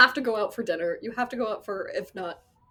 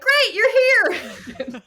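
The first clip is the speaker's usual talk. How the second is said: very high, loud cry of relief; relieved shout as the designer finally walks in; relieved greeting; 11 dB louder than usual. Retold or imitated. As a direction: greet him like a lifeline. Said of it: "so much fear in your eyes".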